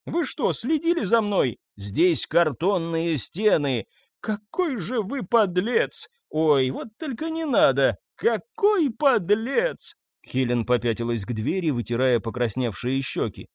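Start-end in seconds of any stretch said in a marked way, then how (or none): 0.1-9.9 high, slow, outraged accusation; indignant outrage. A narrator's reading. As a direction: spit out the insult slowly. Angry quarrel, named scoundrel. (возмущённо, медленно и высоко)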